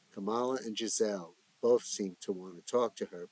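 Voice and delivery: usual speaking voice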